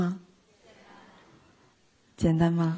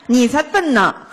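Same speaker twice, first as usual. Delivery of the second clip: mock retort; 5 semitones higher, 5 dB louder, slower than usual. Acted conflict retort.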